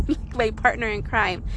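ordinary delivery

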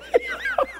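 high-pitched burst of laughter; real laugh. Real, not acted. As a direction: crack up at your own act. Laughs at own demonstration.

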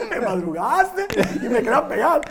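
high mock-indignant quote through laughter; laughing retold accusation. Boxer imitating the rival who remembered, laughing mock outrage.

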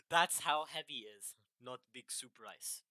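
hammed-up Russian accent; mock-Russian heavy. Showing co-host how easy it is, Russian accent. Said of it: "Do it in a Russian accent".

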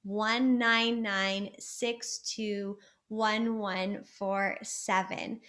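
each digit spoken slowly and deliberately, "One nine nine six two one one four seven"; slow number recital. Shown as a demonstration. Coach showing viewers how to say their number, slow and deliberate. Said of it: "So write this down, say it out loud".

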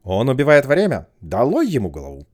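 furious royal yell; shouting. Acted. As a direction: bellow it out. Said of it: "вскочила и заорала".

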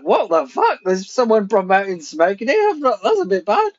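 high, outraged disbelief in the head; appalled inner voice, pitched high; shocked inner voice. Retold or imitated. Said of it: "weird thing in my head".

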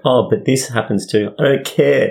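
high-pitched imitated butting-in, then exasperated rebuff; mock exasperation. Venting friend brushing off unwanted opinions, mock exasperation.

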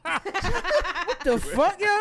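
high burst of laughter around the words; laughing. Real, not acted. Mocking his friend's three-syllables slip, laughing hard. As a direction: lose it laughing.

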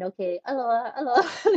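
laughing as she mimics a cheery aloha; a giggly, breaking singsong aloha; mock aloha. New mother mocking the nurses with a laughing aloha. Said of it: "they're not saying".

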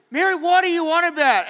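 high, loud exasperated question; hammed-up bewildered husband; baffled Joseph. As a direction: demand an explanation as the puzzled husband. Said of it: "Joseph kind of stands on this side going".

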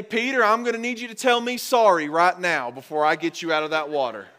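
loud, high mock demand for an apology; mock scolding. Imagined rescuer demanding an apology first, mock scolding. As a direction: insist on it like a stern rescuer, hammed-up.

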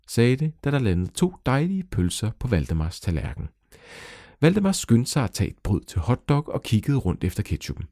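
usual speaking voice

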